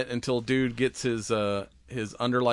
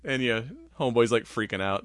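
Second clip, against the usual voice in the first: usual speaking voice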